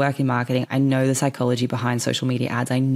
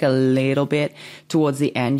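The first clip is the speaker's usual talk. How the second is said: ordinary talk, nothing marked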